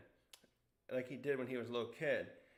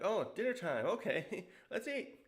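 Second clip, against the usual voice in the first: excited, high-pitched mealtime cheer; lively cartoon voice, rising much higher by the end; Luffy; 6 semitones higher than usual. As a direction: pipe up, thrilled to eat. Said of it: "turns into, you know, like, a Luffy".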